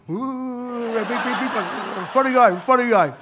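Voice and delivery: hammed-up producer egging him on; mock producer. Producer pushing the comedian on set, mock goading. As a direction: wind him up to be funny. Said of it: "international sign to be funny".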